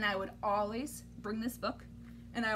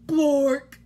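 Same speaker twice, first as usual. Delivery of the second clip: loud silly word; 10 dB louder than usual. Acted. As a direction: bellow the nonsense word. Reading silly word loudly.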